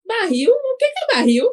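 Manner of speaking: puzzled question. Retold or imitated. Minas woman puzzled by slang.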